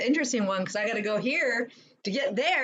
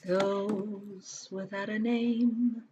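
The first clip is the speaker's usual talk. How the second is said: very slow, drawn-out name; slow dramatic echo; 2 semitones lower, much slower than usual. Acted. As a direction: stretch each word out grandly. Echoing name slowly, playful.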